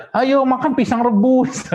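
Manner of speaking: put-on high, slow, sing-song coaxing of a child; mock coaxing parent. Parent coaxing a child to eat, high and slow. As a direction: coax a fussy kid to eat boiled banana.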